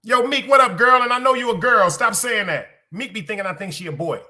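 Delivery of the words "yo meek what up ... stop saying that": high, loud mock scolding; raised-voice playful telling-off; loud teasing call-out. Real, not acted. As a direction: call out to a friend and tease them loudly. Calls out to Meek, teasing scolding.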